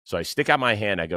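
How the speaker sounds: normal talking voice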